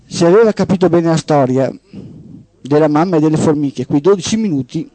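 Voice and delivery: steady radio storytelling voice; host reading a short story on air; story narration. Acted. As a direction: read it like a tale to the listeners. Host reads a story aloud.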